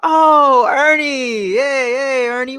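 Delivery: loud, high delighted greeting; excited recognition. Pretend. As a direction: greet him like an old friend. Acted sudden recognition.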